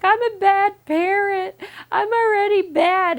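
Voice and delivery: high, loud, teary wail; retold despairing wail. New mom wailing to herself in the doctor's office, teary. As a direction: cry it out like a frazzled mom.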